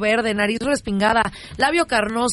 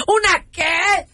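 outraged disbelief; 7 semitones higher, much slower than usual. Acted. Wife repeats question, outraged.

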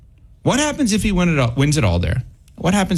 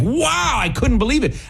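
retold scream; 8 semitones higher than usual. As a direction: re-enact an amazed outburst, pitch leaping up. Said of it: "and I just started screaming".

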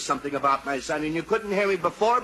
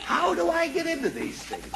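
high-pitched wail of frustration; exasperated lament; 13 semitones higher than usual. Acted. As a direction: bemoan your lot, voice shooting way up. Father bemoans his situation.

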